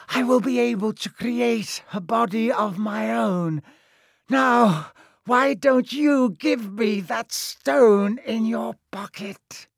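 high, slow, sinister monologue; high villain voice. A narrator's reading. The face explains itself and demands the stone, sinister. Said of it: "a high voice spoke".

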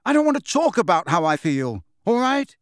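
a touch of rage, rising high at the end; mild angry outburst; shaking with rage. A narrator's reading. Grieving boy snapping at his headmaster, mildly enraged. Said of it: "shaking with rage".

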